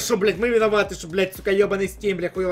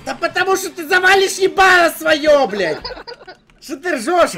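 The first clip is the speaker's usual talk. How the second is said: loud and higher, laughing through it; laughing yell; 7 semitones higher, 5 dB louder than usual. Real, not acted. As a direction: shout at your friend while cracking up. Yelling while laughing at friend.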